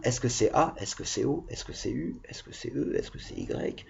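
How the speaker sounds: quiet pupil's run-through of options; pupil thinking aloud. Acted. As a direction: murmur the checklist to yourself. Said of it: "vous dites, tiens".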